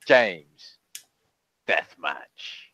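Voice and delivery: ordinary talk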